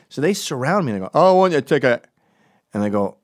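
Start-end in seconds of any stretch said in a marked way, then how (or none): 1.1-2.1 loud, higher Scottish voice; Scottish accent. Retold or imitated. Kids surrounding a ticket seller, loud mock Scottish accent. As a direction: demand it loudly as a mock Scottish kid.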